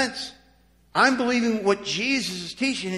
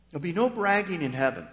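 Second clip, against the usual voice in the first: ordinary talk, nothing marked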